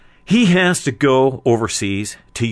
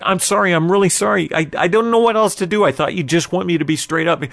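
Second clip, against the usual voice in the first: higher, louder defensive apology; flustered apology; 7 semitones higher, 4 dB louder, faster than usual. Acted. Brother apologising to the distraught man, flustered and defensive. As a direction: plead your case, pitched up and hurried.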